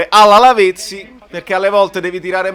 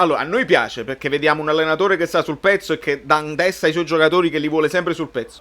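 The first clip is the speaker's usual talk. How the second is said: normal talking voice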